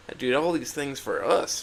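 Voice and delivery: plain reading voice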